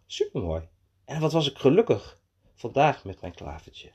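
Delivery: put-on expressive children's storytelling, settling toward the end; animated story narration. A narrator's reading. Narrator telling Mees's day through to sleep, expressive storytelling. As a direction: dramatise the peril, then ease towards bedtime.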